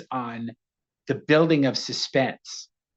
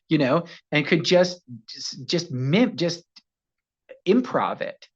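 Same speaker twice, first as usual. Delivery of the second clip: ordinary talk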